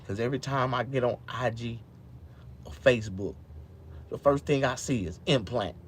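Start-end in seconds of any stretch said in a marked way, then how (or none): none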